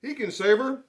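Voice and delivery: loud, high panicked shout; urgent shout for the farm hands; farmer shouting. Acted. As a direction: yell for help across the field. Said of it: "the farmer shouted".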